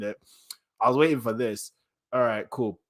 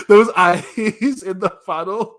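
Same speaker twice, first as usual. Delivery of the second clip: high, loud words squeezed through laughter; laughing in disbelief; 8 semitones higher, 9 dB louder than usual. Real, not acted. Reader reacting to scary eyes, helpless laughing. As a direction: gasp it out while cracking up.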